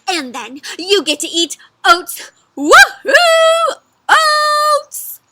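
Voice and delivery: loud, climbing to high whoops; super excited. A narrator's reading. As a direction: cheer wildly as a thrilled horse. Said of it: "horse sounds super excited".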